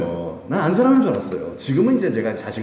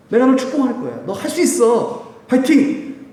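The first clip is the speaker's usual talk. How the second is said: higher and louder fighting cheer; cheering encouragement; 10 semitones higher, 4 dB louder than usual. Acted. Jesus voiced cheering the preacher on, higher and louder. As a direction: shout fighting like a coach.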